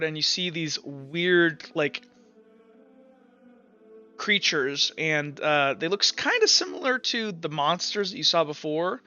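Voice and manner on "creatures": ordinary talk, nothing marked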